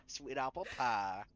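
slow southern drawl; southern accent. Shown as a demonstration. Warming up for the captain's log, southern accent. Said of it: "say sweet apple pie in a southern accent".

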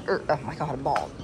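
ordinary delivery

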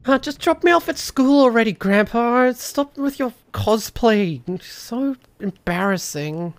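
put-on high, loud embarrassed kid; whiny kid voice. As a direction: beg grandpa like a teen, high. Plays a kid to grandpa.

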